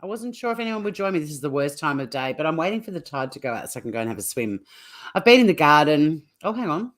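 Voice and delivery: usual speaking voice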